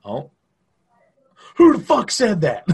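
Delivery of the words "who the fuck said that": loud, high parade-ground bark; barking drill instructor. Acted. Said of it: "It's like Full Metal Jacket".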